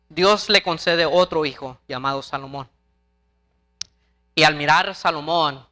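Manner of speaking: usual speaking voice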